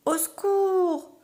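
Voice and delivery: appel au secours très aigu, lent, plus fort; hurlement. A narrator's reading. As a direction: hurler à l'aide, étiré. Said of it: "se met à hurler Minilou". (cri d'appel au secours dans le placard)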